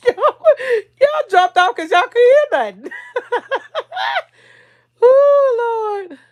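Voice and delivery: high-pitched laughing through the words; cracking up at the dead mic; real laugh breaking into the words. Real, not acted. Laughing at dropped audio.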